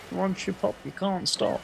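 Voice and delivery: very high, silly mimic voice; mimicking a chat member. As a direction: squeak it like someone quoting a slogan. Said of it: "someone's gonna go".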